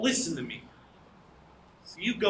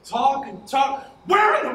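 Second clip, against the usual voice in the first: exasperated shout; 6 semitones higher, 5 dB louder than usual. Acted. Man fed up with talk while hunting his pipe, exasperated shout. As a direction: vent the frustration loudly.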